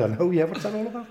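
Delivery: high and loud, chuckling as he says it; laughing through it. Retold or imitated. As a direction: laugh it off, half-bewildered. Retelling his own Scouse-sounding reply.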